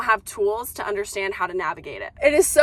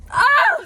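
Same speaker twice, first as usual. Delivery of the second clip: high loud squeal of excitement; excited squeal; 8 dB louder than usual. Real, not acted. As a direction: shriek with thrill. Excited shriek after pitch.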